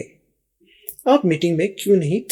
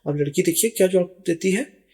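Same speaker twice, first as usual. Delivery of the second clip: ordinary delivery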